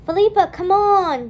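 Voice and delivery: loud, high call; calling her friend. A narrator's reading. Dawn calling for the vanished Felipa, loud. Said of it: "Dawn said".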